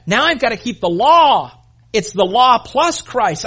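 mock earnest convert, loud and insistent; voicing a legalistic convert. Preacher mimicking a legalist convert, loud and insistent. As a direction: play the rule-bound believer, pressing hard.

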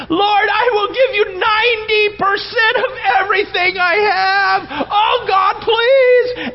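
high, loud, sobbing plea to God; hammed-up tearful shouting to the Lord; crying prayer. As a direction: wail a panicked prayer. Panicked prayer while swimming.

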